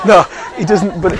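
laughing. Real, not acted. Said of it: "laughs at own joke".

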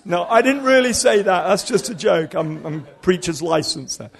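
normal talking voice

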